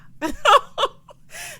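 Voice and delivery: shrieky high cackle; loud high laugh. Real, not acted. Recapper cracking up after quoting TikTok, high cackle.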